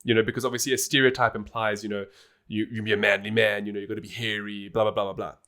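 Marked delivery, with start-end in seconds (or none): none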